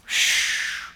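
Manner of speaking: long drawn-out shush. Shown as a demonstration. Preacher illustrating silence to congregation, drawn-out shush. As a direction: hush the room with a Shh. Sounds out silence after naming it.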